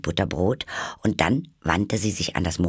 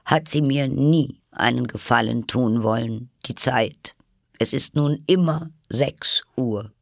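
higher plaintive complaint; sad; 6 semitones higher, 4 dB louder than usual. A narrator's reading. Hatter complains about Time to Alice, mournfully. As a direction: lament with a touch of self-pity. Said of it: "sprach der Hutmacher traurig weiter".

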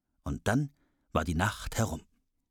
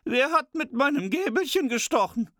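very high, louder dwarf voice; squeaky dwarf; 4 dB louder than usual. A narrator's reading. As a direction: squeal the question as a tiny dwarf. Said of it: "Der fünfte".

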